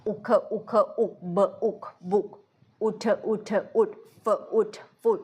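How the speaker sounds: quick clipped อุ drilled syllable by syllable; short u sound. Shown as a demonstration. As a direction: spell out บุก and ฟุด with a short อุ. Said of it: "อันนี้เป็นเสียงอุ เสียงสั้น". (ออกเสียงอุสั้นๆ ทีละพยางค์)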